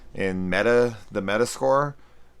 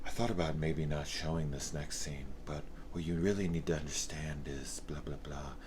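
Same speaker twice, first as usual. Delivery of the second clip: softer, deadpan monotone parody of a serious narrator; serious monotone narration; 3 semitones lower, 9 dB quieter than usual. Retold or imitated. Reviewer mocking the film's serious girl narrator, softer deadpan monotone. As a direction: drone it like a flat movie voiceover, mocking. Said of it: "It's all serious and monotoned".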